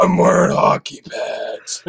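slow, growly superhero impression; Batman. Guest keeps up the joke accent, Batman voice. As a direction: drag it out in a rough vigilante voice. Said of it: "That's the real".

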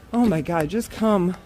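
usual speaking voice

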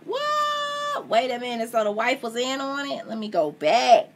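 high, incredulous outburst; real astonishment. Real, not acted. Reader learns the wife was in on it, astonished. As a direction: break off in disbelief at the twist. Reader breaks off, shocked by twist.

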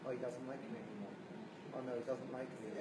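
a lightly played sulky mumble; mumbled sulk. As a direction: mumble it to yourself, hurt. Retold inner sulking voice.

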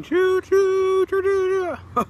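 high, loud choo-choo like a steam train whistle; train whistle imitation. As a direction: sing out each chew like a toy train. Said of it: "It's like a train".

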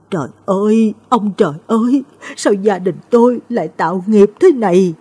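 a lament thrown upward, voice lifted and strained; anguished wail. Acted. Old mother wails to the heavens over her cursed family. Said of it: "Bà ôm mặt gào lên".

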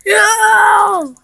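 high, loud drawn-out cry; dismayed shout. Pretend. Player in trouble in the game, long dismayed shout.

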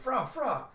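high, loud mock dog bark. The dog Bandit barking at the vampire, high mock bark. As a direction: yap like a small robot dog. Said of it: "Bandit still barking with a".

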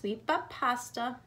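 plain reading voice

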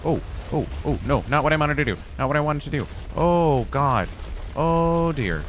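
everything going wrong at once; panicking. Real, not acted. Gamer reacting as the game goes wrong, panicked.

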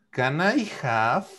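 "Can I have?" said slowly and clearly, rising on "I"; slow teacher model of "Can I have?" with a question rise; slow model phrase. Shown as a demonstration. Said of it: "La tercera sería".

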